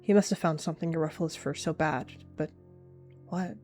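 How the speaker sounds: ordinary delivery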